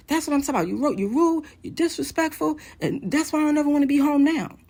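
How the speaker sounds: high, indignant mock tirade; acted-out heated scolding; imitating angry yelling. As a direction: rattle off accusations as the angry husband. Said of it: "He proceeds yelling, being angry".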